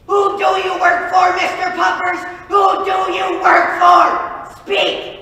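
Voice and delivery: loud, high barking demands; interrogation shout. Acted. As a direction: grill the traitor hard. Interrogating Mister Puppers.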